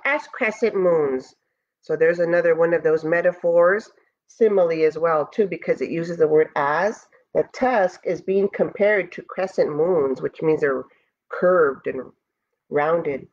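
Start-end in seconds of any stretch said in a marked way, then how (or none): none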